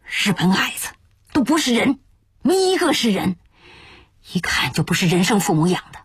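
bitter outburst, pitch leaping on the insults; indignant teahouse waiter's tirade; cursing through gritted teeth. Acted. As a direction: curse them with clenched-jaw hatred. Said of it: "突然咬牙切齿地骂道".

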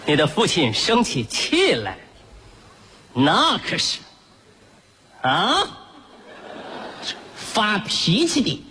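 put-on slow, drawn-out baby-talk threat; talking down to a toddler. Father slowly warns his little son about his temper. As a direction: spell it out slowly for a small child.

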